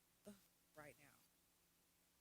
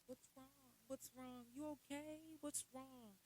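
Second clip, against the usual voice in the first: imitating concerned friends; 6 semitones higher than usual. Everybody asking what's wrong, mimicked high concern. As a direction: fuss over someone in a high, coddling voice. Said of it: "now everybody's like".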